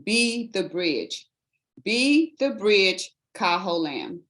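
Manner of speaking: slow, measured contest announcement of the title; formal introduction. Shown as a demonstration. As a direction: announce the title with ceremony, slowly. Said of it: "It would always be your name, the title of the speech".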